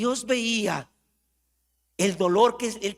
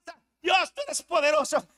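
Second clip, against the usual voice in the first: much higher, cracking churchgoer praise; mock Sunday praise; 12 semitones higher than usual. Preacher mimics his old Sunday praise, high and showy. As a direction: ham up the showy Sunday worship.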